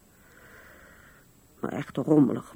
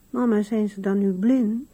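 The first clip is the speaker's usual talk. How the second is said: a hint of a small girl, higher and louder; child's question; 7 semitones higher, 5 dB louder than usual. Mother imitates her young daughter asking a naive question. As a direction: lightly voice the little daughter. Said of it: "En Erika vroeg me".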